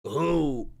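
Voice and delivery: lightly voiced owl hoot; Donald Trump. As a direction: imitate an owl-like politician hooting. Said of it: "but if he was an owl".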